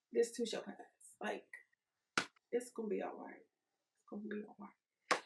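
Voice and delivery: calm them down gently; soothing. Real, not acted. Host softly reassuring stressed listeners it will pass.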